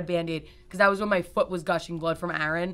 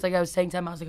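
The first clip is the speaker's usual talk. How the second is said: ordinary talk, nothing marked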